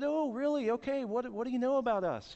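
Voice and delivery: high-pitched, curious missionary imitation; missionary's interested reply. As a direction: mimic an intrigued caller, pitched well up. Missionaries' eager questions acted out.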